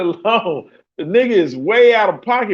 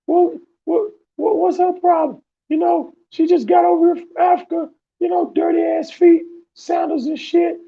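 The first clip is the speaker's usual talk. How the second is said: higher, mock-proud friend voice; clueless buddy; 9 semitones higher than usual. Imitating the friend justifying the pizza date, higher put-on voice. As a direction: ham up the oblivious friend defending himself. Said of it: "He's like".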